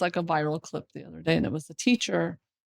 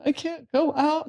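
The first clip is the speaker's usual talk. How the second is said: high, slow mock whimper; imitated crying child; 7 semitones higher, slower than usual. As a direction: whine like a tearful little kid. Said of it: "like, crying".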